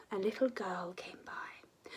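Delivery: ordinary delivery, nothing marked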